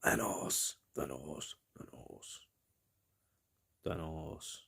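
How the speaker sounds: soft, breathy, drawn-out Thanos, trailing into a whisper. Shown as a demonstration. Gamer savours a villain's name in a hush. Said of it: "sounds a little bit silly".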